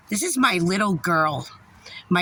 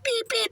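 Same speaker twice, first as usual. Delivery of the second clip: high mock beep beep; squeaky imitation beep; beeping noise; 13 semitones higher, 4 dB quieter than usual. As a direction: chirp it like a machine beeping. Mimics a lift's beeping.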